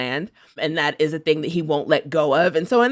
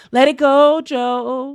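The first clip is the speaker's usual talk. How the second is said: higher, louder teasing plea; teasing call-out; 9 semitones higher, 6 dB louder, much slower than usual. Real, not acted. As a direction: call out to Joe with a grin.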